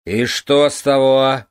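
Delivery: loud, slow, combative challenge; belligerent drunk. Acted. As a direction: pick a drunken fight. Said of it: "воинственно осведомился король". (воинственный пьяный вызов)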